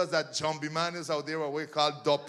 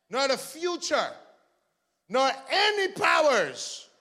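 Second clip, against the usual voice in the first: preaching scripture; 6 semitones higher, 7 dB louder, slower than usual. Real, not acted.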